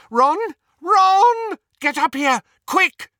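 very high, loud urgent scream. A narrator's reading. Hermione screaming over the banister for Ron, screamed. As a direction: yell urgently for help upstairs. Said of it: "and screamed".

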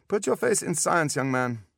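put-on loud, high German-accented advice; emphatic German specialist signing off; German doctor. A narrator's reading. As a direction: lecture the boy in a German accent.

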